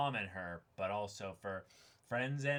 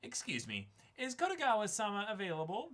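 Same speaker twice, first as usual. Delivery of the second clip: higher polite business caller; polite phone voice; 8 semitones higher, faster than usual. Acted. Tour agent calling the dive shop, higher formal phone voice. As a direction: sound formal and bright on the phone.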